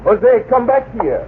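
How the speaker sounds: high urgent call after him. Acted. Professor calls after Jose running into the boulders. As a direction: call him back as he runs off.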